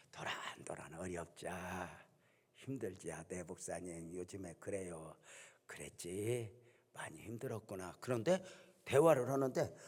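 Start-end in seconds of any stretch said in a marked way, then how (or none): 0.1-5.1 much softer, lower, slow heartfelt murmur; confiding softly. Retold or imitated. Deacon confiding her troubles over coffee. 5.7-8.0 much softer, lower consoling reply; gentle comfort. Retold or imitated. Pastor consoling the deacon.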